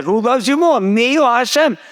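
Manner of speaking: high-pitched, pressing question; acted father demanding an answer; insistent repeat.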